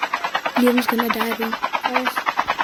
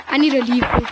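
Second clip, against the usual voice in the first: usual speaking voice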